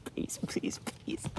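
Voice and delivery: breathless whispered please please; whispered begging. Retold or imitated. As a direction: beg in a desperate whisper. Mother begging her son.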